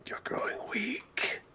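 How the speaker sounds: slow, breathy whisper; haunting voice. Acted. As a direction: draw it out as a ghostly whisper. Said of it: "again, voices come by".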